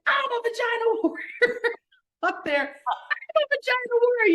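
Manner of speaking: high, laughing tearful shout; laughing and crying. Retold or imitated. Atop the pole repeating the chant, laughing high. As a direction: shout it through laughter and tears. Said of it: "I was like".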